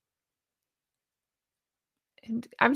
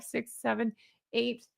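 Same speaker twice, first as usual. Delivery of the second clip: normal talking voice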